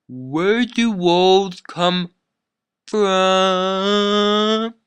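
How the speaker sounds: put-on slow, high, dopey kid voice; dumb kid question. Annoying camper interrupting the ballad, slow high dopey voice. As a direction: stretch it out like a clueless kid. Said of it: "that kid was like".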